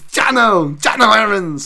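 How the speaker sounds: high, loud, hammed-up Danish-Dutch accent; overdone Dutch accent. Streamer hamming up a fighter's name, overdone Dutch accent. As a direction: belt it out in a mock Dutch accent. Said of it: "say it in an overly Danish or Dutch accent".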